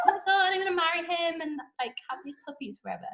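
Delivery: fast, giddy and laughing. Retold or imitated. Catherine gushing about marrying him and the puppies, fast and laughing. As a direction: gush it out at speed. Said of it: "and she's like".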